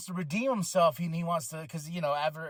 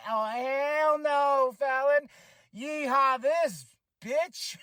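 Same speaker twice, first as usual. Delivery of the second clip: higher, slow, drawn-out sassy taunt; sassy Tiffy impression; 8 semitones higher, much slower than usual. Recapping Tiffy's post-match taunt at Fallon, higher slow sass. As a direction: camp it up like a diva mocking her rival. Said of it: "Tiffy said".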